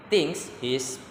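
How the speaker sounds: normal talking voice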